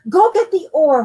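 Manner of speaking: loud high shouted order; father yelling at his son; yelled. Acted. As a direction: bark the order urgently. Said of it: "Papa yelled".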